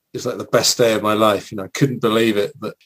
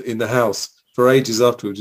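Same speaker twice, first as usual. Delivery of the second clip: ordinary talk, nothing marked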